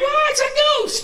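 put-on very high shriek, like a little girl; hammed-up high-pitched scream; mock terrified scream. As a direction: shriek it like you saw a ghost.

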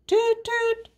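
very high toot-toot. A narrator's reading. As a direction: toot it like a little horn. Mother demonstrates toot toot.

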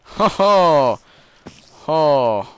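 loud high delighted hoot; gleeful ho ho. Real, not acted. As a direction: crow with delight. Finding a great item.

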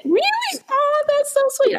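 very high, delighted squeal; thrilled squeal. Retold or imitated. Reacting to a fan in the car, very high squeal. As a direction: squeal with flattered surprise. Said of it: "And I went".

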